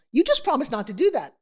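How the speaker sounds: a tiny conscience wagging its finger; little angel voice. Retold or imitated. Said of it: "I hear this little thing go".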